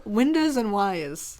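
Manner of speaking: slow "windas and wi-uhs", windows and wires; imitated New York pronunciation. Echoing the two giveaway words, windows and wires in New York style.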